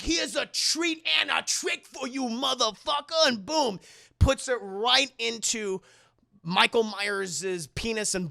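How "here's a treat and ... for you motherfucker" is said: higher, shouted action-hero quip; hammed-up one-liner. [name] about to shock [name], shouted quip. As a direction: toss the quip out with swagger. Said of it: "and is like".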